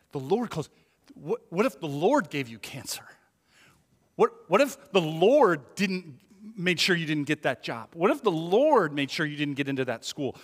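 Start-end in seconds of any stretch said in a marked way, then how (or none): none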